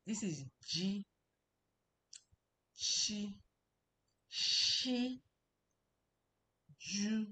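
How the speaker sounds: crisp repeated drill of "Shu", pausing between; sound drills. Shown as a demonstration. Teacher drilling students on "Shu" and "Chi" sounds. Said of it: "It's different".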